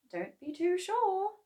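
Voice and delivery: high, sceptical wife voice; doubtful wife. A narrator's reading. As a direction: cast doubt on his boast. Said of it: "said Missus Cranky".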